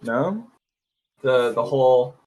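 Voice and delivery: usual speaking voice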